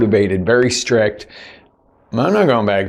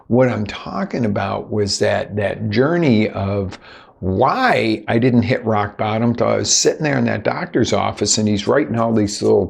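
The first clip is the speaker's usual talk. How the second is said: ordinary delivery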